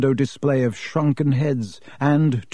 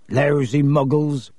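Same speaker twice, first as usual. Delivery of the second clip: slow, growled grumble; slower than usual. A narrator's reading. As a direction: growl with contempt, drawing it out. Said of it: "growled Hagrid".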